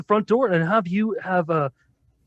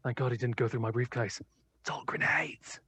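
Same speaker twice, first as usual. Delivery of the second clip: hushed, conspiratorial whisper; whispered aside; 6 semitones lower, 11 dB quieter than usual. Acted. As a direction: whisper it to a friend so no one hears. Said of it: "make an aside to Mahoshi".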